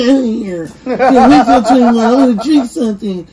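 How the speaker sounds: laughing mock eagerness. Mocking younger self's eagerness.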